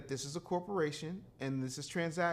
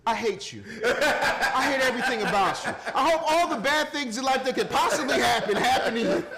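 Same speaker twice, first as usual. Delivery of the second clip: louder, laughing pretend hate, pitched up; mock hatred; 5 semitones higher, 8 dB louder than usual. To a friend he loves, a laughing mock curse. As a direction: ham up hating him while laughing through it.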